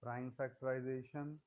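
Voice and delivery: very faint, far from the mic; barely audible. Real, not acted. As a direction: voice dropping out, barely there. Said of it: "your voice is very low".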